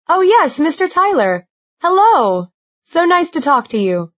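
high, flustered eager greeting; bright rush of surprise; excited. Acted. Surprised author greets caller.

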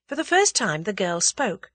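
ordinary delivery, nothing marked

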